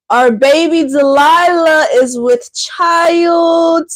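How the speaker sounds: slow, drawn-out big news; teasing pet news; mock announcement. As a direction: unveil it slowly like a grand reveal. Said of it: "guess what?".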